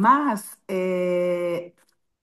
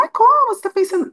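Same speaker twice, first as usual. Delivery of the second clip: mock startled husband, much higher and louder; imitating husband's alarm; 11 semitones higher, 4 dB louder, faster than usual. Husband reacting to wife's death premonition, startled. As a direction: jump up high in disbelief. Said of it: "Meu marido falou".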